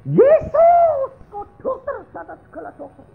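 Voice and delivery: high-pitched imitated hysterical cry to Jesus; hysterical screaming. Wife praying over her dying husband, acted hysterical screaming. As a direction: scream out a desperate prayer, acting hysterical. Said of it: "dia teriak-teriak kayak orang histeri".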